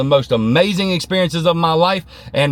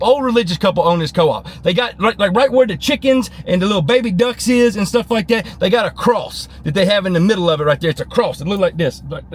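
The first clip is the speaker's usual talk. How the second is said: ordinary talk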